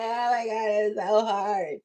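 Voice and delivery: mock crying. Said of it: "You in the car crying like".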